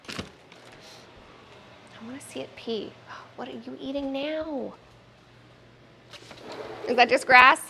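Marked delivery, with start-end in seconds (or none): 0.1-4.7 slow hushed whisper. Real, not acted. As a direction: whisper it slowly.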